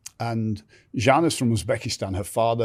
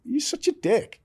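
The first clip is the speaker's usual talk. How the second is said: much higher, playful mock of the wife; wife's teasing voice. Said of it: "She's like".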